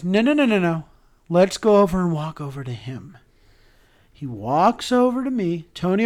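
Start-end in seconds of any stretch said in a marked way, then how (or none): 0.0-3.2 quick run of raised, louder no's; retold insistent refusal. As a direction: imitate someone eagerly waving off an offer. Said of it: "And he goes".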